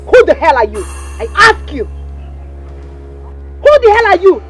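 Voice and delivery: high, drawn-out angry demand; slow, rising-pitched confrontation; furious challenge. Acted. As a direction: stretch each word out in outrage.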